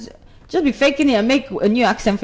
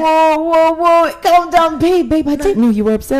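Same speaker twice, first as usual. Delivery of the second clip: higher and louder, a hammed-up placating husband; imitating her husband's alarmed "calm down, babe", pitched up; mock soothing husband; 8 semitones higher, 6 dB louder than usual. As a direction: play the startled husband backing off his angry wife. Husband soothing his angry wife.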